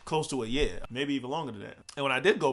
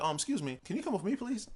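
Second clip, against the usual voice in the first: higher, softer, sweetly polite imitation; sweet polite girl; 7 semitones higher, 4 dB quieter than usual. Young clerk politely asking the thief to follow, mild sweet voice. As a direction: ask nicely, like a friendly clerk. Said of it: "it's just the way she said it".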